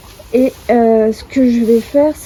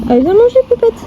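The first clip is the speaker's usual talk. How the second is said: high, louder call to a pet; 12 semitones higher, 5 dB louder, faster than usual. Real, not acted. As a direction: coax the pony to come eat. Calling the pony to eat.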